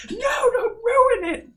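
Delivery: high, slow, loud wail; hammed-up whine, drawn out; mock tearful protest. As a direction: wail it like a crushed kid. Voicing dismay at dog-training joke.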